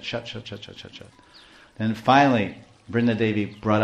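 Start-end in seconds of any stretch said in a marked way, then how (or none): 0.0-1.1 quick, soft run of repeated words; dismissive shushing. Acted. Krishna silencing all the failed alarm clocks, soft repeated dismissal. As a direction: wave it away under the breath, getting softer. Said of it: "Krishna just said".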